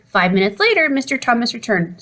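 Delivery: ordinary delivery, nothing marked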